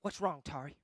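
low, whispered check-in; hushed, private concern; concerned pastor. Acted. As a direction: lean in and ask softly. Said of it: "his job to come and say".

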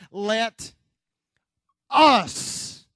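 "Let", a long pause, then "us" loud; stress on us. Shown as a demonstration. Preacher showing congregation the key word, heavy stress on "us". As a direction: set "us" apart and punch it hard. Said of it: "puts the emphasis on one word".

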